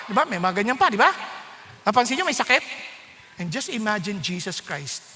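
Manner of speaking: ordinary delivery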